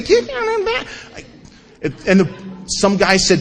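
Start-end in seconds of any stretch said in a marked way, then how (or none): 0.0-0.9 mock chirpy AA greeter. Mocking the happy AA people.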